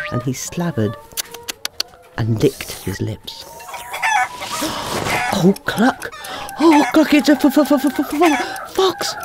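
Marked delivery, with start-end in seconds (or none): 3.7-5.3 high, startled gasp; frightened gasp. Acted. Hens spot the fox. 5.3-9.1 high-pitched panicked hen, stuttering; flapping panic. Acted. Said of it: "flapped Henrietta".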